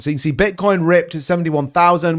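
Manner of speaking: normal talking voice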